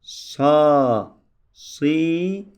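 loud, spaced syllable drill; reciting syllables. Shown as a demonstration. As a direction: call out each sound for the class to copy. Teacher drills letter sounds.

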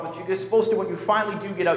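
ordinary talk

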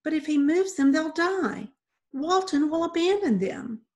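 put-on high, urgent girl's voice; desperate plea. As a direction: plead for the kittens' lives. Tearful girl pleads for kittens.

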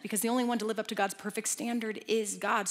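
usual speaking voice

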